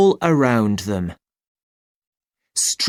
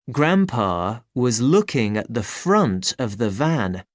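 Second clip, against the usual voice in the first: plain reading voice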